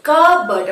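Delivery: loud কাপবোর্ড as kuh-burd, p silent; correct pronunciation. Shown as a demonstration. Teacher recapping for students, correct pronunciation. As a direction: punch out কাপবোর্ড with no p. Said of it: "জোর দেবো না আমরা পি".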